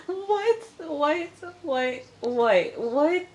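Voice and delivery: very high, repeated squeals of disbelief; incredulous. Acted. Vlogger reacting to a pointless reality-show conversation, high-pitched incredulous. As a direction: squeak each one out as if baffled.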